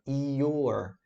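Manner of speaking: "your" stretched into I, O and R; teacher modeling a drawn-out "your". Shown as a demonstration. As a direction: drag out "your", every sound audible. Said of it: "eu gosto de puxar assim".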